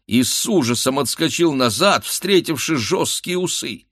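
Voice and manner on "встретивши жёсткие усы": usual speaking voice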